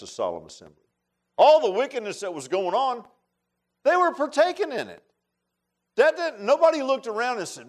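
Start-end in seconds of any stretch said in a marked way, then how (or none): 1.4-7.7 rising, louder preaching, much higher. Acted. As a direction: build the fire, climb in pitch. Preacher building to climax.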